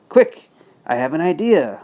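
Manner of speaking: ordinary delivery, nothing marked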